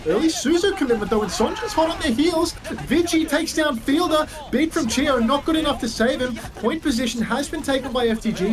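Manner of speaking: excited caster play-by-play; esports caster. Acted. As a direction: call the fight, hyped and high. Hyped play-by-play of fight.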